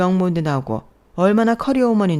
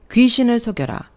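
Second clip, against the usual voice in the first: scoff, stress on 귀신을 with a falling end; louder, higher mocking jab; scoffing retort; 5 semitones higher, 4 dB louder than usual. Scoffing at the bride's denial. (귀신을 강조하며 코웃음 치듯)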